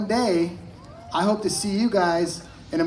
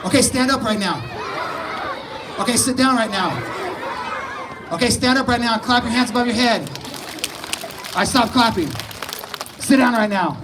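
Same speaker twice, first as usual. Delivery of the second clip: drill instructor; 4 semitones higher than usual. Pretend. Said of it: "take part in the first day of Marine Corps basic training".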